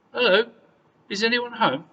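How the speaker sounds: high, loud call into an empty house. A narrator's reading. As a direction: call out to anyone inside. Calling into empty cottage.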